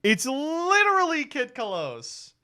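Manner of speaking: high, drawn-out exclamation of disbelief; astonished. Real, not acted. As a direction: wail it out high and slow, stunned. Astonished at the resemblance.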